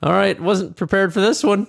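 high, startled mock panic; caught off guard. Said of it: "I was like".

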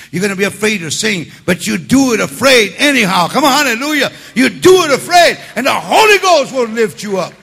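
building from a strong call to shouting; preaching at full force. Real, not acted. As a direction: shout it over the whole church. Preaching climax.

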